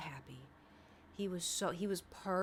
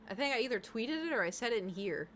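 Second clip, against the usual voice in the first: ordinary talk, nothing marked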